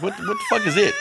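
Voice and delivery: half laughing, half crying in disbelief. Retold or imitated. Asking the old lady, laughing in disbelief. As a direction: voice cracking with laughter. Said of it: "I'm like".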